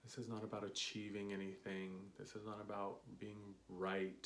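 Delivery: calm them into the breath; guided meditation. Acted.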